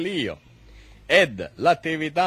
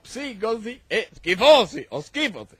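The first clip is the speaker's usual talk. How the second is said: pompous lecturer; 6 semitones higher, 3 dB louder than usual. Acted. Mock-learned lecture, voice raised.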